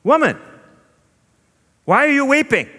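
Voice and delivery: high, loud teasing question; Jesus playful. Acted. Jesus playfully teasing his grieving sister Mary, high and bright. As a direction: tease her like an older brother. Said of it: "and Jesus says".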